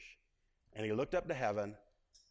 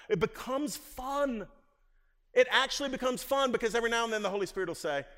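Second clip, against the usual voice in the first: excited build; 9 semitones higher, 6 dB louder than usual. Real, not acted. Preacher builds to excited point.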